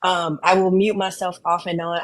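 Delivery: ordinary talk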